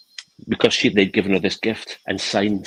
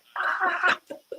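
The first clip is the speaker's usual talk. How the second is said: very high-pitched imitated squeal; high drawn-out squeal of delight; overjoyed squeal; 4 dB quieter than usual. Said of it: "like, overjoyed".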